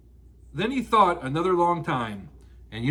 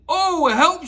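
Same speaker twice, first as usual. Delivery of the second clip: alarmed cry; 7 semitones higher than usual. Acted. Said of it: "said Pooh".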